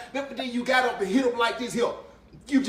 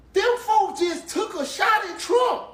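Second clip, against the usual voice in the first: higher, louder, slow shocked outcry; stunned viewer shouting at the TV; 7 semitones higher, 3 dB louder, slower than usual. Real, not acted. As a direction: blurt it out in disbelief. Shocked at assassination attempt on TV.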